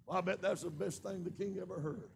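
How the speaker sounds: normal talking voice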